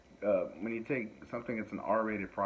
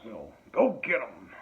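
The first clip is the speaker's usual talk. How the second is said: lampoon a flag-waving action hero; mock gung-ho patriot; 5 semitones higher, 7 dB louder than usual. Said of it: "turned the character into, like".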